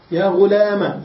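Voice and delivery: "غلام" said "ghulama"; fatha ending. Shown as a demonstration. Teacher reading out the three vowellings, a ending. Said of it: "بالكسر والفتح والضم".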